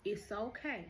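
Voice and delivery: ordinary delivery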